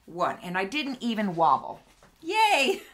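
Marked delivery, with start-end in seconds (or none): 2.2-2.9 high, loud little cheer of triumph; excited cheer. Real, not acted. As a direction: cheer like you just won. Celebrates not wobbling.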